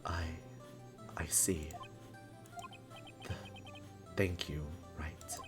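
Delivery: low, very soft stammer; halting and subdued, deep in your range; awkward, hesitant. Acted. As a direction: stumble quietly through unfamiliar thanks. Edgeworth struggles to say thanks.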